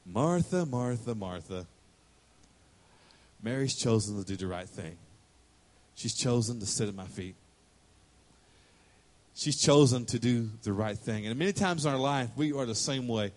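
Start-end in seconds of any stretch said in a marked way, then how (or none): 0.0-1.7 name falling lower and softer each time; soothing repeated name; gentle calling. Retold or imitated. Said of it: "He just says". 3.4-11.2 low, soft and calm; tender Jesus voice; gentle correction. Retold or imitated.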